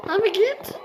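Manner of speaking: frantic, voice jumping high; desperate high pleading. Real, not acted. Takılan tuşa çaresizce yalvarıyor. (oyuna tiz sesle çaresizce yalvarma)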